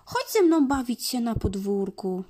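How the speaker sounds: high, yappy puppy voice calling to play; barking puppy. Acted. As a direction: bark it out like an eager little dog. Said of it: "Piesek zaszczekał".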